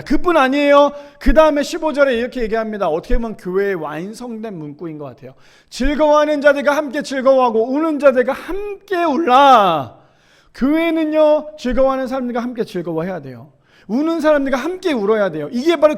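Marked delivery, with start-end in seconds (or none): 5.7-10.0 high, loud pulpit reading, last phrase drawn out; scripture proclamation. Acted. Preacher gives the church its key verse, proclaiming loudly. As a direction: proclaim the verse, swelling on the final words.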